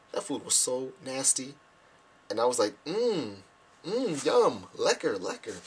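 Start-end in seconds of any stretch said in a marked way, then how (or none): 2.9-5.6 swooping, exaggerated yummy noises; feigned delight. Guest pretending to like nasty food, hammed-up delight. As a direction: overdo the pleasure, pitch swooping up.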